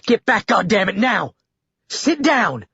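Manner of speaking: high, urgent yelled commands; shouting orders. Acted. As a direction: bark him back into his seat. Lily aims gun at attacker.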